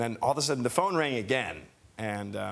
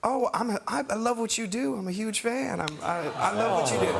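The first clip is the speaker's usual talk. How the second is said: high, halting fan-like gushing; 7 semitones higher than usual. Retold or imitated.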